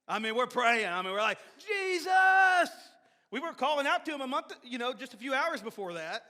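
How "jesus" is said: high, loud shout of the name; panicked cry. Retold or imitated. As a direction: cry out for help in terror. Retold panicked prayer in closet.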